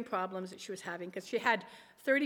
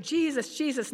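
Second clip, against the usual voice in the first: higher, louder urgent call; retold cry out; 4 semitones higher, 7 dB louder than usual. Mother praying aloud while on the emergency phone, retold cry out. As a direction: call on Jesus in re-enacted panic.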